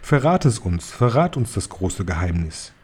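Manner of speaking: ordinary delivery, nothing marked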